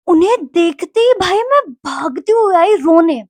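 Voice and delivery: high, loud, drawn-out exclamation; hammed-up distressed storytelling; mock wailing. New student fleeing the studio to cry, dramatised wail.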